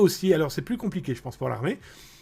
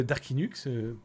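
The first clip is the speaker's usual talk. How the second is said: ordinary delivery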